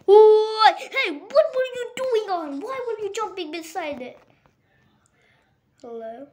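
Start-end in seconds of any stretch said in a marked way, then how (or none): 0.1-4.0 sudden high loud alarm; startled shout. Real, not acted. Gamer startled by a creepy mob, loud high alarm. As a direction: jump out of your skin mid-sentence.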